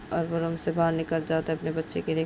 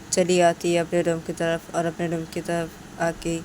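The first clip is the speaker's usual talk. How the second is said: ordinary delivery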